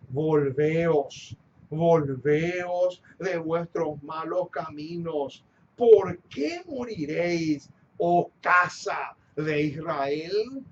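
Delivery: low-pitched, solemn scripture proclamation; scripture reading. Acted. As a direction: proclaim the verse gravely. Reads Bible verse aloud. (proclamación bíblica solemne)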